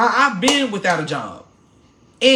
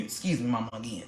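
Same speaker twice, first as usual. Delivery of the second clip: sheepish aside; 10 semitones lower, 12 dB quieter, faster than usual. Real, not acted. Apologizing aside for cursing.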